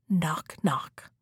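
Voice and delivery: breathy whispered knocking sound; hushed knock knock. A narrator's reading. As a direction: whisper the two knocks like a tap on wood. Narrator knocks at the door.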